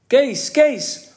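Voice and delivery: high, loud sing-out call; excited schoolboys' holler. A narrator's reading. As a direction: shout the name across a classroom. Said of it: "hoorde hij aan alle kanten roepen".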